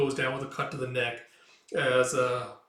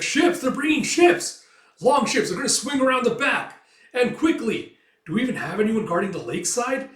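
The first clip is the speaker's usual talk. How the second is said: very high, loud frantic alarm; panicked shout; 10 semitones higher, 8 dB louder than usual. Acted. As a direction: scream the warning in a panic. Defender panics at ships.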